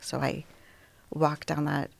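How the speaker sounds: ordinary talk, nothing marked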